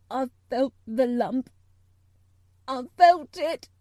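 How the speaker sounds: put-on loud, tearful outburst of a boy; choked out. A narrator's reading. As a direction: choke it out between sobs. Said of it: "choked out Colin".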